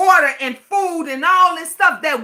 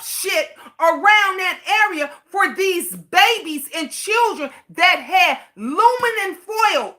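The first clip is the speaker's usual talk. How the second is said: real outrage; 6 semitones higher than usual. Real, not acted. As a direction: let the anger boil over at the crowd. Outraged rant about caged children.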